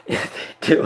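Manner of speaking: breathy giggling half-whisper; laughing whisper. Real, not acted. Streamer to chat, laughing under breath. Repeats line, breaking into laughter.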